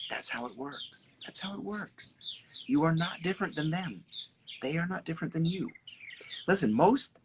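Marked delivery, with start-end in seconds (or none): 0.1-2.2 hushed and slow; quiet, knowing tone; soft emphasis. Real, not acted. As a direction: let it land softly.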